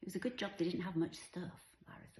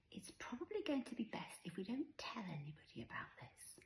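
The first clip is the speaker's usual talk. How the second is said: hushed and high, careful; confiding mum; 6 semitones higher, 7 dB quieter than usual. A narrator's reading. Mother asks her little girl to keep the flood secret, confiding. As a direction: softly coax a child into a secret.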